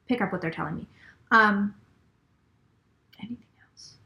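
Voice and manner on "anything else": whisper. Real, not acted. Asking the spirits, aside.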